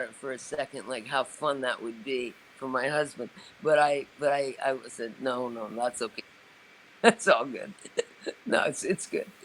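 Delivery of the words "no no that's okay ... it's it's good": giggly, embarrassed decline; laughing it off gently; laughing polite refusal. Retold or imitated. Declining June's offer, laughing.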